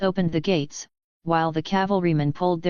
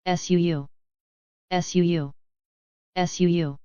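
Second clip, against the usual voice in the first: arrow whoosh. A narrator's reading. Arrow barrage whistling.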